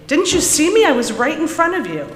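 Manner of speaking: high, loud scolding; little girl berating Larry, loud and high; angry little girl. A narrator's reading. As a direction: scold the boy who knocked you over. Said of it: "the little girl shrieked at Larry".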